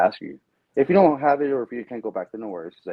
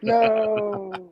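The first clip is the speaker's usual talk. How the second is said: high, drawn-out mock cry of NO; slow-motion no; 10 semitones higher than usual. Dad turning as his son spoils the ruse, slow-motion no. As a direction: stretch it out like a film disaster. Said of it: "It's like in slow motion".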